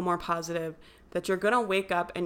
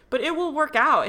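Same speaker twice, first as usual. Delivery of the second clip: louder and higher, sure of it; emphatic reassurance; 8 semitones higher, 6 dB louder than usual. Real, not acted. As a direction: break through the worry with conviction. Reassuring after imagined panic.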